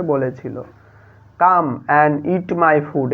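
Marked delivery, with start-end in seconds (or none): 1.4-3.1 very slow, each word apart; slow dictation. Shown as a demonstration. Teacher giving the quiz answer to pupils, very slowly. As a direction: spell it out slowly for the class.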